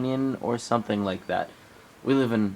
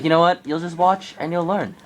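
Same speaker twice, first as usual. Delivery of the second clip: cocky boast; 8 semitones higher, 4 dB louder than usual. Retold or imitated. Friend voiced boasting.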